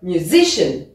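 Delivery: "musician" with SI stressed, then falling; light MU, weight on SI in "musician"; stress on second syllable. Shown as a demonstration. Teacher corrects expected first-syllable stress, second syllable stressed. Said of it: "mais non, c'est bien musician".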